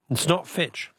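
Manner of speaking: normal talking voice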